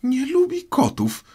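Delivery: very high, loud indignant squeal; shrill mousy outburst; piercing, passionate cry. A narrator's reading. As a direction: shriek it as an outraged mouse. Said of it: "krzyknęła przeraźliwie i z pasją mysz".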